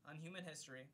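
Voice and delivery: formal book-reading voice; reading aloud. Acted. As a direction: read it like an audiobook narrator. Reads a book foreword aloud.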